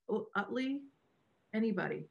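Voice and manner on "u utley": "Utley" as UT-lee, short uh, rising questioningly; guessing the pronunciation. Shown as a demonstration. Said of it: "don't ever know how to pronounce".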